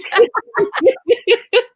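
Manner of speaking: shrill burst of laughter; squealing laugh; high laugh. Real, not acted. Laughing at chat.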